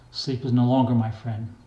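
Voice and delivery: ordinary delivery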